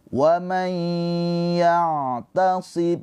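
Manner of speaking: Wa may ya'tasim, may hummed for two counts; held nasal. Shown as a demonstration. Teacher models the held ghunnah for students. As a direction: hold the nasal hum on may. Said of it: "Ditahan dua harokat".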